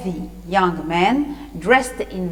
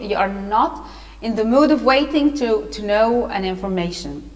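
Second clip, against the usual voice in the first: usual speaking voice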